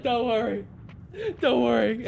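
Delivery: high-pitched, plaintive comforting; pained mock reassurance. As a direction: console them in a high, pained voice. Acted consoling after the kill.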